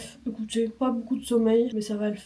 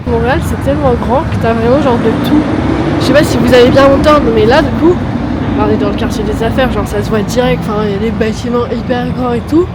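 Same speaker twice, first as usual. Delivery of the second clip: talking loud over traffic; raised voice; 3 semitones higher, 13 dB louder than usual. Real, not acted. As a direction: project over the street noise. Talking over loud city noise. (parler fort dans le bruit)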